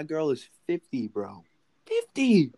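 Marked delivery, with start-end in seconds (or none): 1.9-2.5 excited. Real, not acted. Stressing his girl is fifty.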